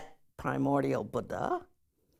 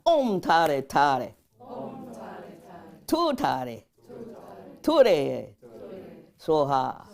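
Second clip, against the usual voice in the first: loud, drawn-out phrases for repeating; mantra call-out; 6 dB louder, much slower than usual. Shown as a demonstration. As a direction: lead a group through the mantra, loudly. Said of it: "Can you say that?".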